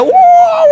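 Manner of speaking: loud, very high imitated whoop; sudden high prank holler; prank yell. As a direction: burst out with a startling high yell. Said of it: "saya prank dia".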